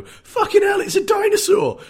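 very high, loud squeal of amazement; awestruck yell. Retold or imitated. Viewer first seeing the dinosaur, high excited shout. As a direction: shout it sky-high in wonder. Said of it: "and didn't go".

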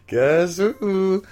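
high, playful call; mock creature greeting, pitched high; comic raptor voice. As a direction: sing it out as a silly surprise. Echoes the joke raptor voice.

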